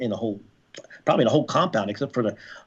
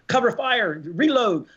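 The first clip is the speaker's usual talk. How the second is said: retold yell; 9 semitones higher, slower than usual. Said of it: "you scream".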